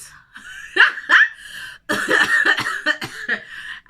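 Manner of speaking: loud, high burst of laughter; big happy laugh. Real, not acted. Vlogger recalling landing at Gatwick, big joyful laugh.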